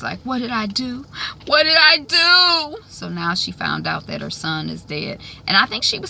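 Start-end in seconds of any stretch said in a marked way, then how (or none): 0.2-1.0 higher-pitched tearful question; imitated crying. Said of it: "and she's crying". 1.4-2.8 slow, high, loud mock wail; imitated wailing. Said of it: "and she's crying".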